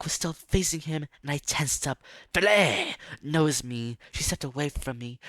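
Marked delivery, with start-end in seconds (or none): 2.3-3.0 loud sudden call of the name; calling out sharply. Acted. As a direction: bark the name to stop him. Snapping at him in story.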